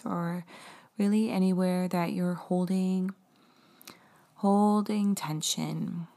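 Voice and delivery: slow, gentle meditation voice; guided meditation. Acted. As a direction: guide the breathing calmly and slowly. Leads a guided breathing meditation.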